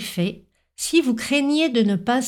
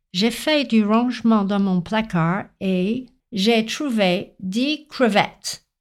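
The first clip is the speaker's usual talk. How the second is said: put-on French with an English accent, gliding vowels, stress landing on crevettes' last syllable; anglophone learner's accent. Pupil telling the teacher about a cupboard, English-accented French. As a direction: imitate an English speaker labouring through French. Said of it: "quand un élève anglophone m'a dit".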